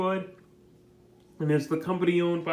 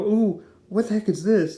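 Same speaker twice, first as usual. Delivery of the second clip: mock-shocked, higher and louder; hammed-up surprised yelp; shocked gasp; 4 semitones higher, 6 dB louder than usual. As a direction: jolt of alarm at bad news. Agent shocked reading the paper.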